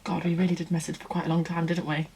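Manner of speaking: soft, half-whispered aside; murmuring to herself. Real, not acted. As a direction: mutter it softly to yourself while scrolling. Murmuring to herself while searching messages.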